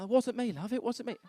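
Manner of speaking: high-pitched, hasty protest; pleading denial. Acted. Man denying it to his love, high and pleading. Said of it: "And she's come back round".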